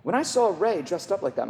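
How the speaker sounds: normal talking voice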